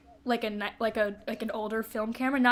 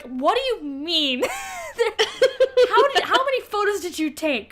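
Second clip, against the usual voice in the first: incredulous; 9 semitones higher, 4 dB louder than usual. Retold or imitated. Incredulous reaction.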